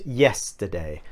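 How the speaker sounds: yesterday with the weight on yes, the rest flowing; teacher models the word yesterday, stress on YES. Shown as a demonstration. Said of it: "the stress on this word is on the yes part".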